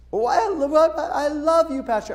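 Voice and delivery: put-on high childlike pleading; little girl voice. Girl telling the pastor she loves him, high childlike voice. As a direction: play a small girl who loves him.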